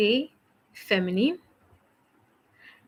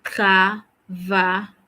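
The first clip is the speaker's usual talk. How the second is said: Travailler again, slowly and a bit louder; slow model word; 4 dB louder, slower than usual. Shown as a demonstration. Teacher leading repeat-after-me drill, slowly. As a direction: stretch it out once more.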